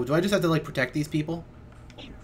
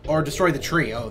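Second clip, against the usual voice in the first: ordinary talk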